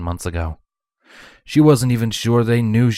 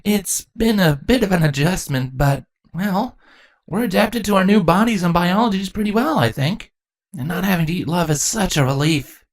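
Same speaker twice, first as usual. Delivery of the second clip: put-on higher young male voice, earnest and relieved; Thorax reassuring; 11 semitones higher than usual. A narrator's reading. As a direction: tell her honestly it went well.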